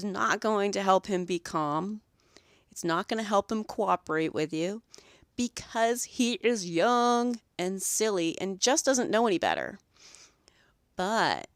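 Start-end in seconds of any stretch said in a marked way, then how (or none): none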